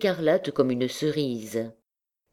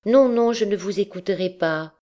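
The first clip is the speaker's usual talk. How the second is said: put-on higher, childishly playful insistence; playful girl; 5 semitones higher than usual. A narrator's reading. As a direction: tease like a lovestruck girl. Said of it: "avec un enfantillage plein de folie".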